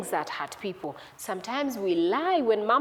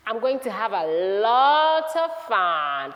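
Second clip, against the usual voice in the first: slow, with a hugely stretched "lot"; drawn-out storybook relish; gleeful relish; 4 dB louder than usual. Acted. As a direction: savour the words, drawing them out. Son gleefully plans his spending.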